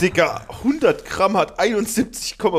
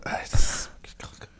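whisper; 4 dB quieter, faster than usual. Real, not acted. Whispered reaction to salty taste.

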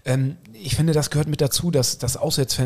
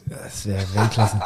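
laughing; 5 semitones lower, slower than usual. Real, not acted. Amused reply to the idea.

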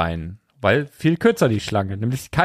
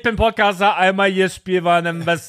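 mock pushy podcaster; 6 semitones higher than usual. Mocking cohost's pushing in.

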